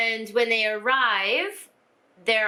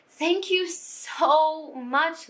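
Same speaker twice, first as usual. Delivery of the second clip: heartfelt thanks; 6 semitones higher, much slower than usual. Real, not acted. Thanking the friend who sent books.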